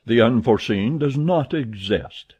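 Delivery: usual speaking voice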